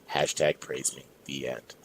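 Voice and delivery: plain reading voice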